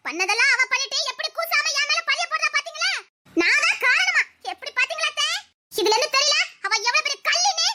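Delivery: high, outraged complaint to the elders; indignant accusation. Acted. Woman protesting blame to her aunt, indignant.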